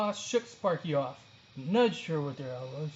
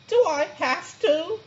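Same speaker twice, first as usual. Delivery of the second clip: much higher, slow whiny moan; moaning sloth; 12 semitones higher, 4 dB louder, slower than usual.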